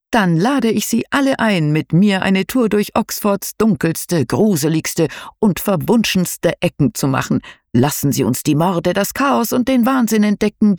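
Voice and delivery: high, showy ghost-tour host voice; theatrical tour guide. Acted. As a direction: play the spooky guide with flair. Guide invites group on tour.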